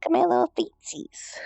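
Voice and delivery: put-on whispered baby talk; breathy little cutesy whisper. Cutesy whisper showing feet.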